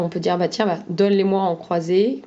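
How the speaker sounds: ordinary delivery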